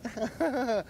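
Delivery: high put-on little voice; fishy voice. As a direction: squeak it out like a pet. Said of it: "You know how my fishy goes?".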